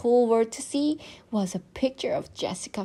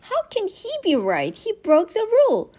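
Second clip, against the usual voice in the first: much higher and louder, whiny outrage; indignant protest; 13 semitones higher, 7 dB louder than usual. Acted. Judy protests to Mom about spelling rules, outraged whine. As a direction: wail that it's unfair.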